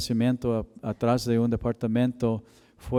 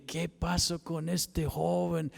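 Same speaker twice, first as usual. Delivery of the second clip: higher, softer, slow lament; a grieving, hushed cry to heaven; 5 semitones higher, 7 dB quieter, slower than usual. Retold or imitated. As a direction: ask God through quiet tears. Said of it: "llorando y clamando".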